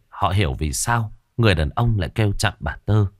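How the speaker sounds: ordinary delivery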